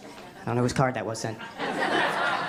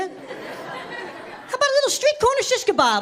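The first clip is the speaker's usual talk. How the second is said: mock excited inner voice; 12 semitones higher, slower than usual.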